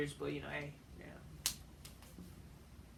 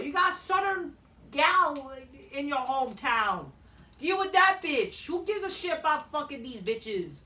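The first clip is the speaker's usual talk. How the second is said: furious rant; 6 semitones higher, 7 dB louder than usual. Real, not acted. Wrestling fan yelling at a boring backstage feud, furious. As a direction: shout it like you're fed up with the whole show. Ranting about wrestling storyline.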